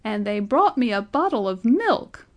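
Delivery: put-on high, loud, indignant old man; shrill outrage; shrill protest. A narrator's reading. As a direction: let the voice leap up in protest. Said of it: "his voice rose to a shrill note of protest".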